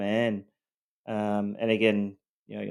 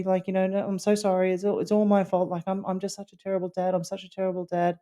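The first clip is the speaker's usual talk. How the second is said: high, fast, pleading string of sorries; mock a father groveling to his son-in-law; imitating the apologetic dad; 9 semitones higher, faster than usual. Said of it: "He said".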